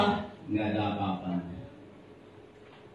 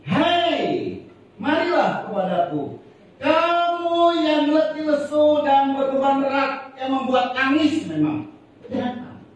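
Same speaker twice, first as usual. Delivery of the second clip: voicing God; 7 semitones higher, slower than usual. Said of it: "kata Tuhan".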